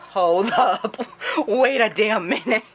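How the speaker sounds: loud, high, emphatic double take; mock sudden realisation. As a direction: halt everything with a loud, amused outburst. Tess's sudden realisation, acted out.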